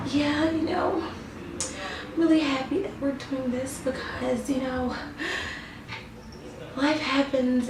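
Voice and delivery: soft, high, mumbly impression of bad acting; mocking Don's acting. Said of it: "Don non-acting as".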